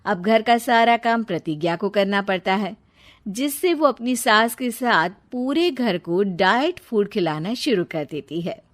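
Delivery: usual speaking voice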